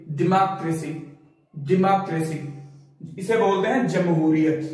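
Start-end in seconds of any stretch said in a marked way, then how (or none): 0.1-2.4 "डेमोक्रेसी" as di-MAA-kruh-see; correct pronunciation. Shown as a demonstration. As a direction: say "डेमोक्रेसी" with short di and open long MAA. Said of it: "correct pronunciation".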